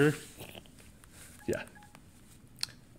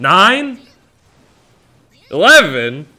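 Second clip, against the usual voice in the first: loud, high disbelieving yelps; shocked; 7 semitones higher, 12 dB louder, slower than usual. Real, not acted. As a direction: cry out in disbelief. Shocked at combo count.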